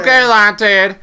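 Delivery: high loud mock meme voice. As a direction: say it in the meme's high voice. Repeating the meme voice.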